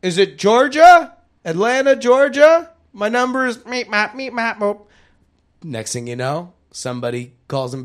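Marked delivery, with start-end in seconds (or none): 0.0-2.7 high, loud, puzzled caller voice; confused caller. Pretend. 2.9-4.8 high nonsense syllables in a funny voice; silly gibberish. Pretend. Caller's number as silly noises.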